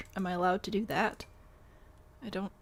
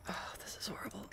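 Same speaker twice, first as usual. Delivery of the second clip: whispered in dismay, much softer; 10 dB quieter, faster than usual. Real, not acted. Looking at her build, a dismayed whisper. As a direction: breathe it out, appalled.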